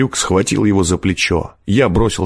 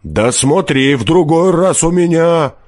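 high, rising warning; shouted threat; 9 semitones higher than usual. A narrator's reading. Forester shouting after freed peasant, threatening. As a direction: threaten him as he goes. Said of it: "закричал он ему вслед".

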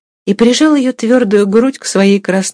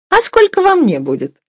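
playful question in a much higher voice; joking; 14 semitones higher than usual. A narrator's reading. Young mistress jokes with the lads at the scales, playfully. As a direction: ask in high, teasing fun. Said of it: "пошутила Катерина Львовна".

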